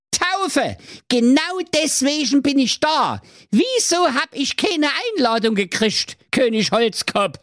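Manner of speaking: sehr hohe, quäkende Hexe; Hexenstimme. Acted. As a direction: genervte alte Hexe. Hexe spricht. (hohe Hexenstimme)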